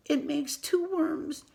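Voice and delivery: normal talking voice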